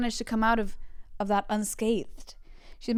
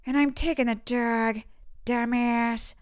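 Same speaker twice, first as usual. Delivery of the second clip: slow, louder, higher film-character voice; Jennifer Coolidge; 3 semitones higher, 4 dB louder, slower than usual. Acted. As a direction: drag out the words as the actress would. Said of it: "when I do impressions".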